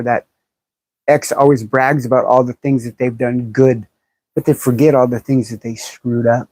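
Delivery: normal talking voice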